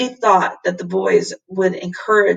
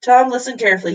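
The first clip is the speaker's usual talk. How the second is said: usual speaking voice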